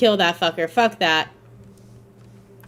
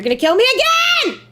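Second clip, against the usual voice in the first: loud, high, slow desperate cry; panicked whining; 8 semitones higher, 6 dB louder, slower than usual. Real, not acted. Player chased by enemies, loud panicked whining. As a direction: plead against being killed again.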